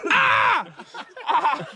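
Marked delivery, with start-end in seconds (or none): 0.1-0.7 a high yelp of pain; sudden pained cry; sharp pain yelp. Real, not acted. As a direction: yelp as the finger hurts. Finger pain.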